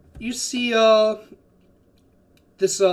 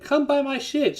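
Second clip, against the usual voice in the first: higher-pitched mock vendor's call; pregón de vendedora; 9 semitones higher than usual. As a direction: call out to passersby like a market seller. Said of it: "calling out to anyone".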